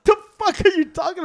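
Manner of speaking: retelling his reaction to his sister with laughing disbelief; incredulous laughing. Retold or imitated. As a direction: keep asking it through laughter. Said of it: "I was like".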